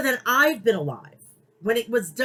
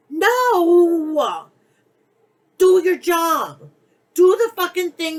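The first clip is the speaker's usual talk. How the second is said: higher and louder, a barked command; angry shout; 6 semitones higher, 5 dB louder, slower than usual. Real, not acted. Fan yelling at Tony Khan to do his job, angry shout. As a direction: shout him down and order him back to work. Scolding the promoter.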